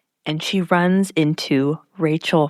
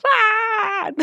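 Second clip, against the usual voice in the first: high short startled yell; sudden high shriek of surprise; startled scream; 13 semitones higher than usual. Retold or imitated. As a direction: scream in fright. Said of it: "They're both like".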